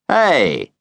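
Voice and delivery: a man calling out cheerfully from his car; cheerful cry. A narrator's reading. Man in a sports car hailing two kids, cheerful call. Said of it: "he cried cheerfully".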